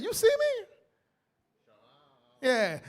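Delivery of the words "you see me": high squeal of a mock demon. Preacher imitates a sister caught out, in a high mock demon squeal. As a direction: play the exposed demon, squeaking. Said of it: "how a lot of you brothers and sisters be when y'all get revealed".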